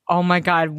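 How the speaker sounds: ordinary talk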